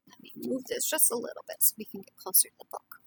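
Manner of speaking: usual speaking voice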